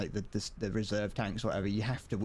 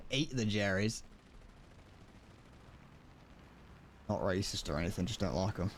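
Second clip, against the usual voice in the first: badly attempted British accent, said casually; 4 dB quieter than usual. Repeating a meme quote to stream viewers, attempted British accent. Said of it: "I can't do a British accent".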